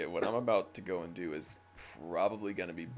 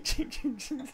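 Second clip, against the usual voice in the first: spur jingle. Retold or imitated. Echoing the armor jingle.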